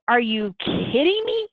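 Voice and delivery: a half-joking are-you-serious; mock disbelief. Answering people who compare a dog's death, mock disbelief. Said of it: "you wanna go".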